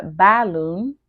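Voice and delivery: balloon with "bal" as in pal; mispronounced first vowel. Shown as a demonstration. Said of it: "Notice that we do not say".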